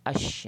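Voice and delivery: "Ash" with A closing onto a held sh; straight into shin. Shown as a demonstration. Teacher isolating the first syllable, "Ash" with long sh. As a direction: cut out the L, hold the sh of "Ash". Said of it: "Masuk terus dalam shin".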